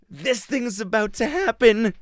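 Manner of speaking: gasp, then high-pitched mock thrill; edge-of-seat excitement. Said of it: "I was on the edge of my seat".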